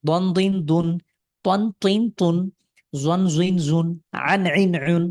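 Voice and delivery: ছন্দে দ্রুত অনুশীলন; drill recitation. Shown as a demonstration. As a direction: rattle off the drill for a child. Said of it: "টান ছাড়া দ্রুত পড়তে হয়".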